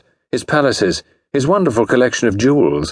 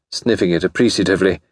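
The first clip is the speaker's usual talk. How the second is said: ordinary delivery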